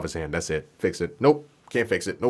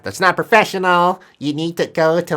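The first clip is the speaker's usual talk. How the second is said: mock scolding; 6 semitones higher, 3 dB louder than usual.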